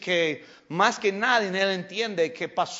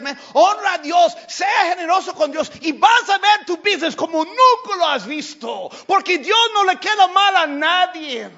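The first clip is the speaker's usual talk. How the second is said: high, loud shouted exhortation; preacher shouts at business owners to be generous to God; preaching at full force; 11 semitones higher, 11 dB louder than usual. Real, not acted. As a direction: fire up the congregation.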